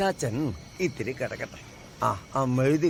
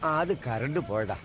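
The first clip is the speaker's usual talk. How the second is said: normal talking voice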